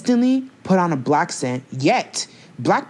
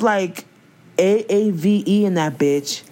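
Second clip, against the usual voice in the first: usual speaking voice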